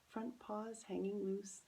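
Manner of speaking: ordinary delivery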